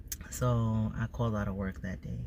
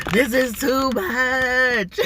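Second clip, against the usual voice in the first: much higher, louder and slow, stretching "too much"; drawn-out overwhelmed cry; 12 semitones higher, 9 dB louder, much slower than usual. Retold or imitated. Voicing her body overwhelmed by stress, drawn-out loud cry. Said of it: "my body is like".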